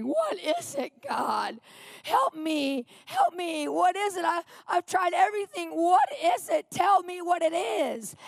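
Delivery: high, pleading cries for help; begging God in pain, voice strained high; desperate cry to God. Retold or imitated. In agony, crying out to God, desperate plea. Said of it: "sit there crying to God".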